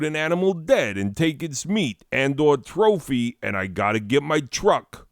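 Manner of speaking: high, fast, hammed-up New York accent; New York caricature. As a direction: rattle it off as an impatient cartoon New Yorker. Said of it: "of people from New York City".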